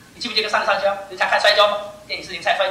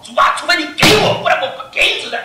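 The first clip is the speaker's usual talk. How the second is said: raised, insistent plea; demonstrated bold prayer; 6 semitones higher, 6 dB louder than usual. Shown as a demonstration. Preacher showing young brothers how to pray, insistent. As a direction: pray it boldly as Jacob did.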